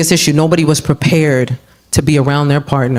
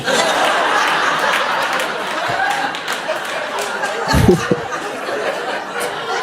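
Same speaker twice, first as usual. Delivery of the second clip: breathless high laugh; 7 dB quieter than usual. Real, not acted.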